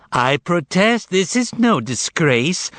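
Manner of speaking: high, indignant cat voice; yelling cat. A narrator's reading. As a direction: yell it like an offended cat. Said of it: "yelled the cat".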